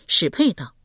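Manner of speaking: usual speaking voice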